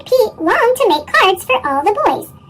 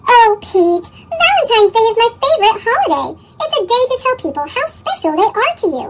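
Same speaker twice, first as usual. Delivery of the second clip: put-on a touch higher, bright little girl pleading; lightly voiced eager girl; 5 semitones higher than usual. A narrator's reading. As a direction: voice Callie a bit higher, insisting fast at the end. Callie's character voice.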